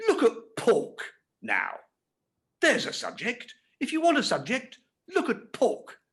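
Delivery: slow, pompous, rising to a high pitch; a self-satisfied uncle holding forth on pork; pompous Pumblechook. A narrator's reading. Reader voicing Pumblechook.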